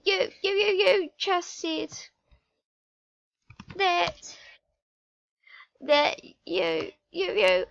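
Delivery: high, halting mock disbelief; shocked stammer. Fia confronts two friends over what she overheard, shocked. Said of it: "she did the shocked face".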